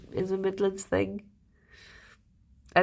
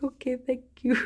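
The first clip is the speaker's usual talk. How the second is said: giggling through the words; thanks him with a laugh; 4 semitones higher than usual. Retold or imitated. Touched girlfriend thanks Alex, laughing. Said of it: "I was like".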